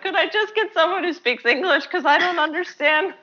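high-pitched, laughing as you plead; mock exasperation breaking into high laughter; laughing mock complaint. Student joking about professors she couldn't follow, high laughing complaint.

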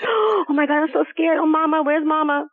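hammed-up childish panic; a frightened child voicing the gut's fear; scared child. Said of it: "is like a child".

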